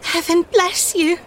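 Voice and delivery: high, drawn-out thanks near tears; tearful gratitude. A narrator's reading. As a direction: bless him as if about to cry. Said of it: "and burst into tears".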